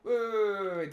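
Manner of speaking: quick alarmed stammer. Real, not acted. Sudden alarm at monster.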